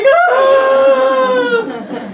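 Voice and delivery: high, loud retold scream with a laugh in it; mock scream. Teller reliving fear between two semis, laughing mock scream. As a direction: shriek it, half laughing at yourself. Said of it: "and I'm just like".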